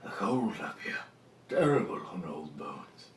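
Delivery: put-on hushed old man grumble; creaky old bones, half whispered. DM voicing old dwarf complaining of cold, hushed.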